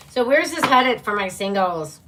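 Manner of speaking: ordinary talk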